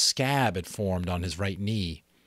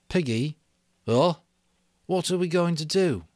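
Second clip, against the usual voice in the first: put-on higher, louder worried boy's voice; anxious boy Ralph; 9 semitones higher, 4 dB louder than usual. Ralph calling to Piggy across the platform, anxious. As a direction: call out to Piggy, uneasy.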